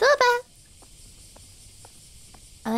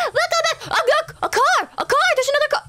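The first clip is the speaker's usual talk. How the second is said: high-pitched excited cry; sudden thrilled high shriek; excited squeal; 14 semitones higher than usual. Real, not acted. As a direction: squeal it out in delight. Excited spotting a car, high squeal.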